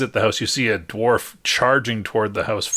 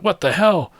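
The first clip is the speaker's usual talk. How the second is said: high, louder startled shout; 10 semitones higher, 5 dB louder than usual. A narrator's reading. Game master voices a character's outcry, shouting. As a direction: shout it out in disbelief. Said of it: "shouts out".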